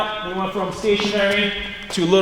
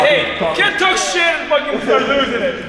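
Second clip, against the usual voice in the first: hype shout; 5 semitones higher than usual. Real, not acted. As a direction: bark it at him to back it up. Hyping up after trash talk.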